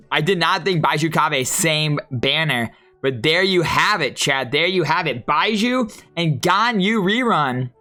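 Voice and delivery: ordinary talk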